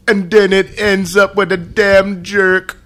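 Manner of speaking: laughing through the words; cracking up mid-sentence. Real, not acted. Teasing about the dream ending.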